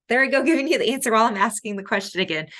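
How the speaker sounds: laughing. Real, not acted. Laughs at giving away answer.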